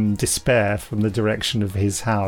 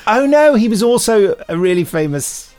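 high, louder "oh no" moment; dawning horror, pitched up; sudden realisation; 10 semitones higher, 5 dB louder than usual. Retold or imitated. Realisation, retold thought.

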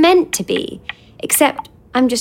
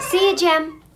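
usual speaking voice

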